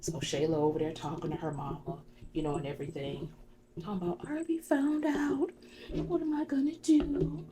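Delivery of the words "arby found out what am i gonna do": very high, whiny imitation of woe; mock distress. Shayla fretting to her mama, mock distraught. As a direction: lament in a put-on high voice. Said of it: "all this crazy stuff".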